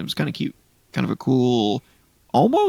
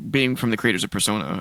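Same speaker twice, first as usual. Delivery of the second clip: normal talking voice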